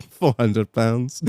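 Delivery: low, slow imitated French accent; slow French deadpan. Kennel man naming his fee, slow French accent. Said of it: "I think he's French".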